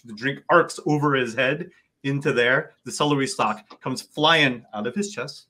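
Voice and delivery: usual speaking voice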